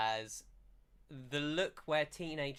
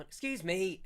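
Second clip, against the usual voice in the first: high, loud "coming through" call; calling out politely; 8 semitones higher, 5 dB louder than usual. Acted. Villager pushing through a crowd, calling out high. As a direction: pipe up over a crowd.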